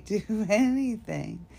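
ordinary talk, nothing marked

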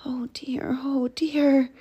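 half-whispered little worry; fretful murmur. Acted. Lost hedgehog frets in snow.

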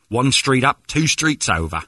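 put-on Aussie accent, lifted; confident bluffing Australian accent; fake Australian accent. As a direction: direct them like a faux Australian local. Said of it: "talk to the waiter as an Australian".

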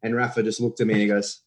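usual speaking voice

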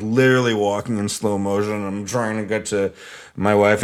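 ordinary delivery

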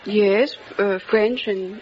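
ordinary delivery

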